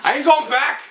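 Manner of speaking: high, loud outlaw cry; mock fugitive. Host playing a fleeing criminal, loud hammed-up outlaw cry. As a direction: play a desperate outlaw.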